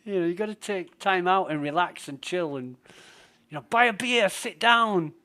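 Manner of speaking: hammed-up Aussie bloke urging him to relax; Australian mate. Australian mate telling him to chill and have a beer, loud Australian accent. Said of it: "my Australian mate Bushy".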